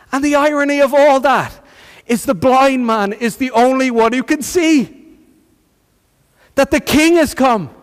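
high, ringing sermon climax; impassioned preaching. Real, not acted. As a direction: proclaim it to the congregation, pitched high.

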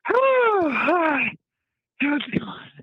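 mock a weak radio voice, high and thin; squeaky bad radio voice. Mocking a bad radio host, high squeak. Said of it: "Or you can sound like".

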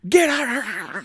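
high, loud strained yell; Evil Ash. Retold or imitated. Villain yelling at his army, high mock yell. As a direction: imitate a villain yelling as his jaw falls off. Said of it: "trying to yell at these people".

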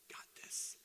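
unvoiced whisper, imitating daughter; whispered in secret; whispered aside. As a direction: hush it like a conspirator.

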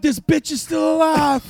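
high, loud and slow in shock. Acted. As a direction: yell it out in disbelief. Shocked at corpse waking.

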